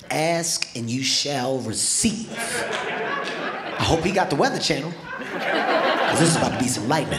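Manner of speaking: drawn-out, smug threat; slow cocky boast. Retold or imitated. Boxer boasts to himself before throwing the jab. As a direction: savor each word like you're about to win.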